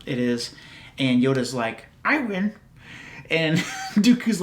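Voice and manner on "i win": Yoda voice. Retold or imitated. Yoda quote in retold scene.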